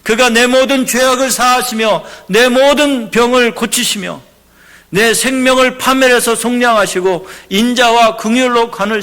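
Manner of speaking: loud, high, declaiming the psalm; impassioned scripture reading. Acted. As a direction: proclaim the verses as a sermon. Reads a psalm aloud in preaching voice.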